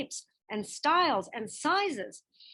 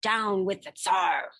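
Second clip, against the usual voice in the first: mild mock shout; 4 semitones lower than usual. A narrator's reading. Boy defying the sentry at street's end, mild shout. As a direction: call it out boldly but understated. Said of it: "and shouted".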